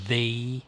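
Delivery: "the" set apart after a pause, said slowly and deliberately; stress on the. Shown as a demonstration. Hero stressing the word "the" to the old man. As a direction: lean on "the" as its own word. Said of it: "And I emphasize the word".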